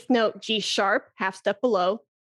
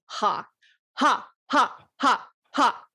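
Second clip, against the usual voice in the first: breath pulses on ha; slower than usual. Shown as a demonstration. Flute teacher shows vibrato haws, slow coughed pulses. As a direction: separate haws, each pushed out from the belly like a small cough. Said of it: "It's like you're saying".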